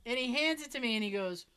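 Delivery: normal talking voice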